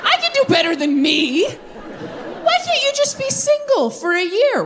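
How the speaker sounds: indignant outburst. Acted. Comic acting out a breakup argument, high indignant protest. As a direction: whine it way up high in mock indignation.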